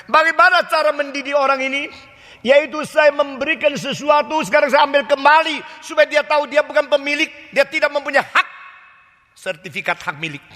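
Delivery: preacher voicing God, stern and emphatic; God's firm reasoning. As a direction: lay down God's lesson with force. God explaining discipline.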